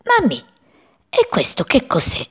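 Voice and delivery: high, wondering little bird call; curious chick. Acted. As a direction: ask mum in wonder. (voce acuta e curiosa di uccellino)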